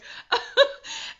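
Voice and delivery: laughing. Real, not acted. Laughs at own aside.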